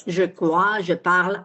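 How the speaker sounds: je crois, je parle with a French zh in je; French pronunciation. Shown as a demonstration. Teacher giving French examples for contrast, French pronunciation. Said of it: "It's not like in French".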